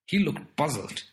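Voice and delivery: ordinary delivery, nothing marked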